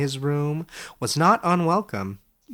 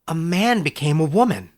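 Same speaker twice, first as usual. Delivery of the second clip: Edric's voice; 5 semitones higher, 5 dB louder than usual. Acted. Young scholar asks his old teacher, amazed and higher. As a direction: ask it wide-eyed, a step higher.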